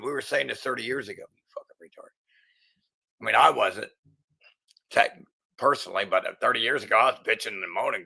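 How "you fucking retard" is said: whispered, under the breath; muttered insult. Real, not acted. Aside insult to critics, whispered low. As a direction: hiss it under your breath.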